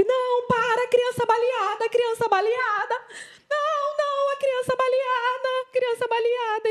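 high, urgent call for help; desperate retold cry. Mother calling for help while holding her shot child, high and urgent.

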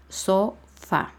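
"sofá" split into so and fá; syllable by syllable. Shown as a demonstration. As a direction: break "sofá" into two separate beats. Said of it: "Son dos sílabas".

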